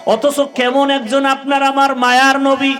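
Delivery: high, loud chanting sermon delivery; full-force preaching. Real, not acted. Preacher rousing youth about the Prophet's example, high full-force chant. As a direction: preach it high, stretching the last words.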